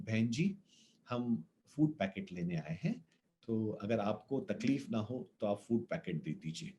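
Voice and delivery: bow your head and ask very softly; humble plea. Retold or imitated. Father begging the lady for food, soft and humble.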